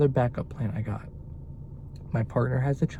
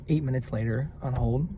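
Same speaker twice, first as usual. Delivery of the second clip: ordinary delivery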